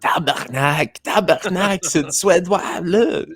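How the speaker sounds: high, exasperated Quebecois swearing; slow hammed-up Quebec cursing; Quebec French accent. Mocking annoyed Quebec local.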